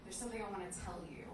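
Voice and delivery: a hint of a nervous boyfriend; hesitant confession. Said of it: "he's like".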